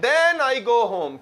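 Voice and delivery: "then I go home" said very slowly, each word separate; slow demo sentence. Shown as a demonstration. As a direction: dictate "then I go home" to the class. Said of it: "Then, टीएचईएन".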